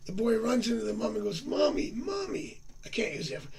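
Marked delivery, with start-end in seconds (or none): 1.4-2.6 put-on slow, higher call of a little boy; boy running in to his mom, calling out; child calling mom. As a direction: call out for mommy like an excited kid. Kid runs calling to mom.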